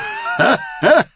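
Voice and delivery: high, mocking cackle; mocking laugh. A narrator's reading. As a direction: laugh at her. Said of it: "lachte er". (höhnisches Lachen)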